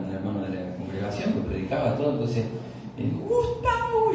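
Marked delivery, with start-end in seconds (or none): 3.3-4.1 a sharp, much higher angry call of the name. Retold or imitated. Preacher calling out his son who crashed a client's car, angry. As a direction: snap the son's name like a furious father.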